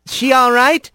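a hint of a wary young rat checking on her; Rat's concerned question. A narrator's reading.

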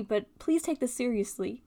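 normal talking voice

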